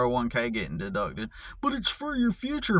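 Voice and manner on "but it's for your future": high mocking singsong of the advice. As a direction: sneer it back high and prissy.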